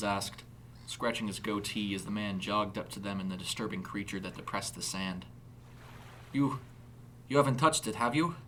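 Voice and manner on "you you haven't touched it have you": put-on higher-pitched German-accented question. A narrator's reading. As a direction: ask haltingly and anxiously in a German accent. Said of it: "a German accent now readily apparent".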